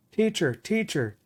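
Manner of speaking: high-pitched cry, held back in volume; mock scream. A narrator's reading. Schoolgirl calling for help, restrained scream. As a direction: narrator lightly voicing a screaming girl. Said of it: "the girl screaming".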